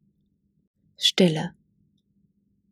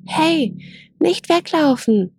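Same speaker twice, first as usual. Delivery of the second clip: higher, louder call after fleeing herd; 9 semitones higher, slower than usual. A narrator's reading. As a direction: shout after them to stop. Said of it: "rief Mojo so laut er konnte".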